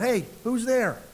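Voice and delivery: mock shout. Homeowner to whoever came in the back door, shouting out. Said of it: "And you shout out".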